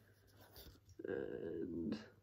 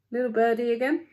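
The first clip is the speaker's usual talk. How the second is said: ordinary delivery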